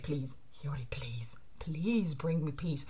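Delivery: soft, low begging whisper; whispered plea. Acted. Villain begging Hiyori for peace, whispered. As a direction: beg in a slow whisper.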